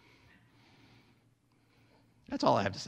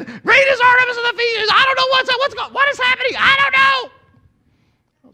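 frantic high crowd scream, much louder; mob screaming; 12 dB louder, faster than usual. Retold or imitated. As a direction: shriek it like a panicked rioter who has no idea why. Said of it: "Everybody's screaming".

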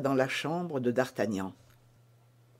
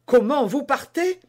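high, louder startled exclamation; startled cry; 8 semitones higher, 5 dB louder than usual. Acted. Constance to D'Artagnan, startled that he is leaving at once. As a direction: gasp it out, caught off guard. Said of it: "s'écria madame Bonacieux".

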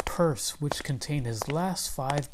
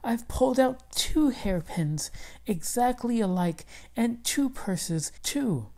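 excited wife; 9 semitones higher than usual. Acted. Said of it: "she shouted".

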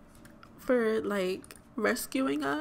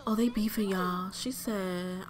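ordinary talk

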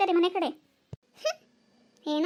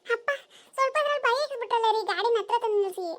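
higher voice with a laugh; 4 semitones higher than usual. Acted. Wife laughing to husband about the scare, laughing. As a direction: laugh through the line.

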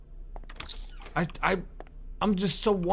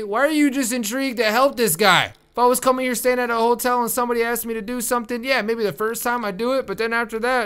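normal talking voice

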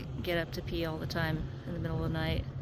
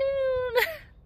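loud burst of laughter; 6 dB louder than usual. Real, not acted. Vlogger laughing at her own greeting.

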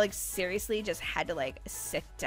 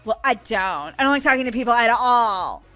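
loud, irritated outburst; grumpy; 3 semitones higher, 9 dB louder than usual. Acted. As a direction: complain bluntly, stretching the ending. Surly worker contradicts coworker.

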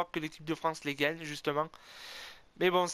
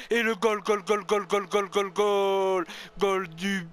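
goal celebration; 2 semitones higher, 5 dB louder, slower than usual. Real, not acted. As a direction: yell goal over and over like a stadium announcer.

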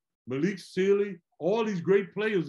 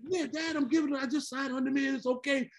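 higher, careless reassurance; breezy son; 8 semitones higher, 3 dB quieter than usual. Retold or imitated. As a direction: brush off dad's worry. Son answering dad in story.

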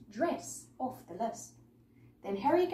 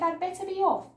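loud, high, rushed; hasty exit; 7 semitones higher, 7 dB louder, faster than usual. A narrator's reading. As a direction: blurt it out and run. Villain flees from fire.

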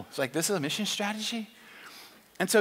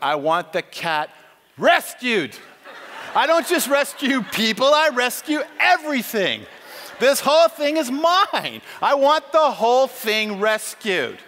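building from firm to loud, high proclamation; emphatic declaration; 4 semitones higher, 7 dB louder than usual. Acted. The Lord insisting everything be rescued, emphatic declaration. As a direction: punch each claim, rising to a full shout.

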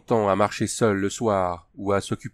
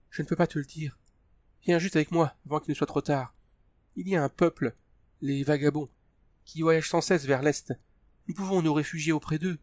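fearful plea; 6 semitones higher than usual. A narrator's reading. Tania pleading, afraid.

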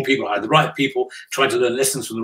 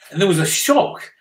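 ordinary delivery

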